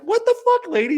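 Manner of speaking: mock outraged squeal; high disbelief. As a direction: shriek it in disbelief. Group in disbelief.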